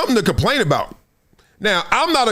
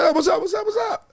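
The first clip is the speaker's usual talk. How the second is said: calling out to a friend, pitched way up; excited greeting; 11 semitones higher, faster than usual. Retold or imitated. Host retelling bumping into Mike Tirico, in a much higher excited greeting.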